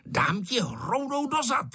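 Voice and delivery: high-pitched threat without real volume; storybook shout, kept at reading level; narrated mild yell. A narrator's reading. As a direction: cry out a threat, pitched well up. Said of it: "křičel Ferda".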